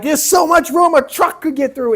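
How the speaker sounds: high, loud mock rant at the car ahead; cussing at the driver. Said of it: "You ever cuss at the guy".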